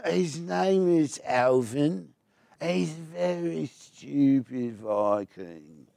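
dim Viking voice. Shown as a demonstration. Asked how it sounds, he demos his dim Viking slowly. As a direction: play a very stupid Viking, dragging the words. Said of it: "What does it sound like?".

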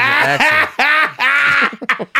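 breaks into laughter mid-phrase; words cracking into a laugh. Real, not acted. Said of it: "Why would you laugh?".

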